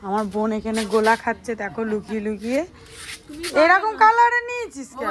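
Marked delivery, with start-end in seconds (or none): 3.6-5.1 loud teasing question. Real, not acted. Teasing sister about her ice colour.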